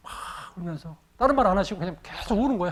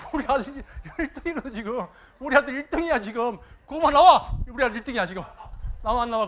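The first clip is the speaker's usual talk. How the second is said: higher, laughing boast of his son's rank; bragging with a laugh, high and bright; laughing proud boast; 4 semitones higher, 4 dB louder than usual. Retold or imitated. Father brags to the whole village that his son is first, laughing loudly.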